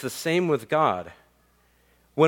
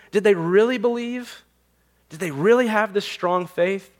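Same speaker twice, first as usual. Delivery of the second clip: high, incredulous voice of a doubter; doubting questions; 7 semitones higher than usual. Pretend. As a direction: ask as the skeptic who can't believe it. Imagined doubting listener asks.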